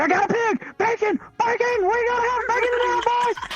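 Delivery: high hooting yell, hammed up; mock yell. Hiding in a bush, taunting the cops with a mock yell. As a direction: whoop it from the bush like a kid.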